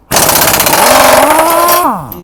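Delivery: loud sharp cry of effort; sudden pained yell. Real, not acted. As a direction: yelp as something slips. Sudden loud shout while working.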